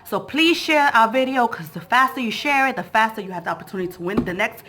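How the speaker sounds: ordinary talk, nothing marked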